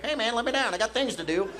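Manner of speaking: high, protesting little turtle voice; high-pitched mock protest; indignant turtle. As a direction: play a picked-up turtle complaining. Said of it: "He was like a turtle".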